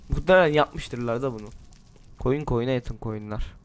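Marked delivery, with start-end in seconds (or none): 2.2-3.5 softer, slower replay of the pun; quiet repeat. Shown as a demonstration. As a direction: savour the joke quietly. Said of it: "Yeni espri". (kelime oyununu alçak sesle yavaşça tekrarlama)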